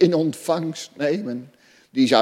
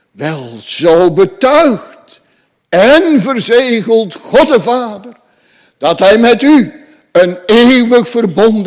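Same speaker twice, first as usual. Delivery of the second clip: higher, louder, fervent sermon voice; full-force preaching; 6 semitones higher, 9 dB louder than usual. Acted. Preacher proclaiming God's covenant to the congregation, fervent and loud.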